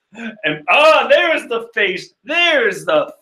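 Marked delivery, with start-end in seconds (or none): none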